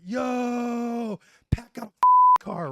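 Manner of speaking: repeating Barbini's taunt, shrieking high and loud; mocking shout. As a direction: squeal the taunt to roast him. Retelling Barbini's roast again.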